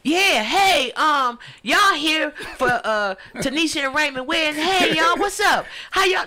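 loud, high, hyped-up emcee; hype wedding MC. Pretend. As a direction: shout it out like a party host. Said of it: "I'ma be on the microphone".

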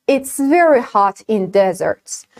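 It's very hot in deserts, spoken very slowly, each word clear; very slow demo. Shown as a demonstration. Teacher reads the example very slowly for students. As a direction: stretch every word for learners. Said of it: "For example".

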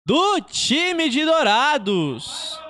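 long high stretched goal cry; goal shout. Real, not acted. Goal call.

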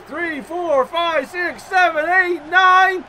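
high count building to a shout; escalating count-out. Pretend. Wrestling fan counting out an opponent, rising shouted count.

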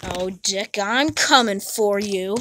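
quiet menace. Acted. Whispered threat.

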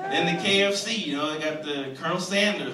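ordinary talk

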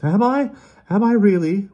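very high, loud questioning; challenging retort. A narrator's reading. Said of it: "a weary frown curled his lips".

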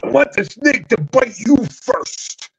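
high slow Macho voice; worried Macho asking; high alarmed Macho voice; Macho Man impression. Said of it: "Macho goes over there".

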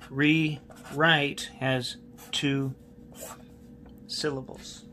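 Rewrite has two syllables, each word drawn out slowly; slow it right down so the class remembers; slow, spelled-out reminder. Shown as a demonstration. Teacher to class, slow deliberate memory aid. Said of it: "it's easy to remember".

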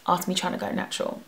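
ordinary talk

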